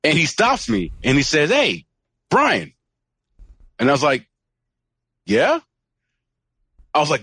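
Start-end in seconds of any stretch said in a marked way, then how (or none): 1.5-2.7 higher-pitched imitation of a stranger's hail; stranger calling out. Retelling how a smoking stranger stopped him, calling out. 5.3-5.6 high, rising retold "yeah?"; wary questioning reply. Retelling his reply to the stranger, puzzled and questioning.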